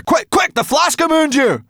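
high, loud, urgent demand; desperate cry. A narrator's reading. Sorrel reaching back for the flask, desperate high cry. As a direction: yell for it in desperation. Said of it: "Desperately, she reached behind her".

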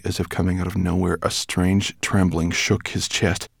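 ordinary delivery

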